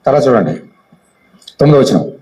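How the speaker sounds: ordinary delivery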